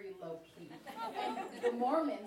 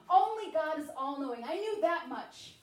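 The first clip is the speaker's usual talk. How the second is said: normal talking voice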